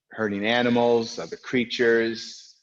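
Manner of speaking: normal talking voice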